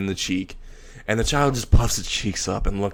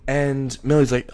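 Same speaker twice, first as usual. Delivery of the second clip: ordinary delivery, nothing marked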